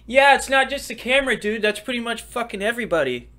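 high loud mocking heckler voice. Mocking himself as a heckler, high and loud. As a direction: jeer at him in a put-on high voice.

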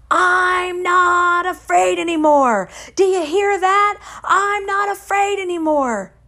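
high, drawn-out defiant yell; screaming. Retold or imitated. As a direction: scream it out like an emboldened boy. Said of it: "he starts screaming these words".

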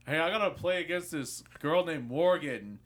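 lightly voiced opponent asking for Morgan; retold call-out. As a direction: call across the room for her. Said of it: "and goes".

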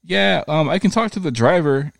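high, breezy lady agent voice; mock phone agent. Mocking the FedEx lady to his cohost in a high voice. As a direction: pitch way up and play her careless.

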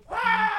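high, loud mock shriek; wild war cry. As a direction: imitate a man charging off with a yell. Imitating the man's cry running off.

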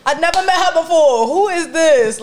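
high loud incredulous; indignant who-is-this; confused outrage. Retold or imitated. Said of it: "I'm like".

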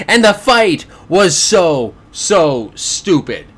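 loud start, then a slow, drawn-out "so stupid"; stress on SO, SO STUPID. Real, not acted. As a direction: hammer each "so" separately and stretch "stupid". Emphatic repeat of his verdict.

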